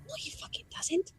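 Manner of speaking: breathy whispered denial, under the breath. Real, not acted. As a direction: hiss it so the table barely hears. Players reacting quietly at table.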